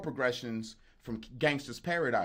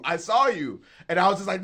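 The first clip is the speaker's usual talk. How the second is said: ordinary talk, nothing marked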